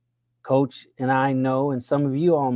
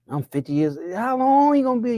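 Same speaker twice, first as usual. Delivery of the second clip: ordinary talk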